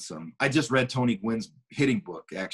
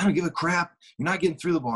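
higher mock coach's yell; yelling coach, imitated at a higher pitch; retold yelling; 7 semitones higher, faster than usual. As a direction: voice an irked coach, not at full volume. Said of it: "he's yelling at me".

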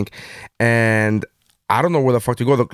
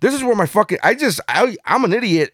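mock inner rant; 10 semitones higher than usual. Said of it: "I'm like".

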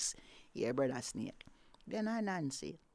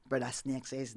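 ordinary delivery, nothing marked

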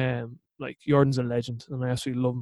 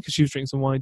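ordinary talk

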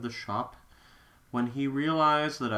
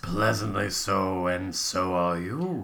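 low seductive man; 8 semitones lower than usual. Acted. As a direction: purr it low into her ear. Voicing Joey in story.

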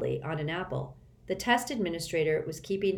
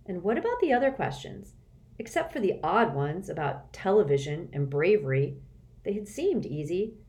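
plain reading voice

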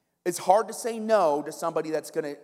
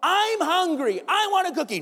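put-on whiny kid; 10 semitones higher, 3 dB louder than usual. Kid who won't accept no, pestering parent, whiny. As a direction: nag relentlessly like a whining kid. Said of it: "They keep on going".

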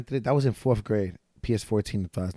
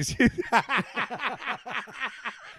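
high burst of laughter; real laughter; 10 semitones higher than usual. Real, not acted. Comic laughing at the lisp joke, real high laughter. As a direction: crack up for a couple of seconds.

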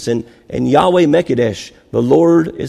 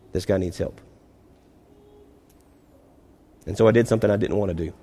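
hushed, heartfelt sermon aside; sincere quiet confession; 4 semitones lower, 5 dB quieter than usual. Acted. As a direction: lean in and confess it gently to the church.

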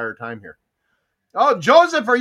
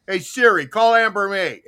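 raised-voice order to a voice assistant; yelled phone command; 5 dB louder than usual. Acted. Host shows how he tells Siri to phone Amber, called out loud. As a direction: call it out so the phone picks it up. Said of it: "but when I yelled out".

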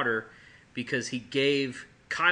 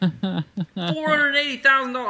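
high, loud cry of disbelief; shouting in sheer disbelief; incredulous outburst; 12 dB louder than usual. Real, not acted. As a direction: blurt the figure out in shrill astonishment. Incredulous at huge judgment sum.

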